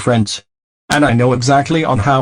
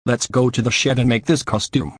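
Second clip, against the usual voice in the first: ordinary talk, nothing marked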